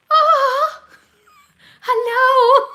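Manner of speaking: very high, loud cooing; high-pitched gushing coo; touched squeal. Real, not acted. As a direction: squeal it as if at something adorable. Touched by viewer's son's nickname.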